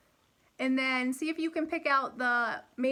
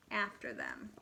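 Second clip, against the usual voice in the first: put-on lively storybook reading for kids; animated read-aloud for first graders; reading to children; 3 semitones higher than usual. A narrator's reading. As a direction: read it like a picture book at storytime. Teacher reads fairy tale aloud.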